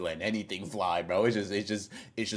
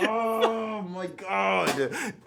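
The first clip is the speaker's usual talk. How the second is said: high, strained laugh-cry; laughing in pain; 11 semitones higher, 7 dB louder, much slower than usual. Real, not acted. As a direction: laugh through the cramps, barely breathing. Laughing through stomach pain.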